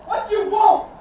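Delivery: high, loud shout across distance. Real, not acted. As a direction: yell back to a teammate.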